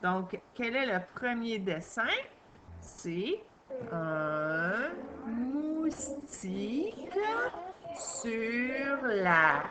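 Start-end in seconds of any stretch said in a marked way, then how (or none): 2.8-9.6 slow reading. Shown as a demonstration. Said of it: "reading sentence slowly".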